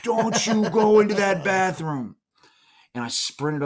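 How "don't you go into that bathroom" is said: high, loud, drawn-out scolding; wife's scolding warning. Retold or imitated. Husband imagines his wife's warning about the bathroom, scolding. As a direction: imitate a finger-wagging wife, slow and high. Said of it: "my wife's finger going".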